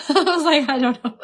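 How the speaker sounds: laughing through the words. Real, not acted. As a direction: giggle it out, embarrassed. Laughing at own mistake.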